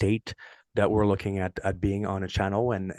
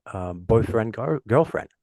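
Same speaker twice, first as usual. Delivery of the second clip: usual speaking voice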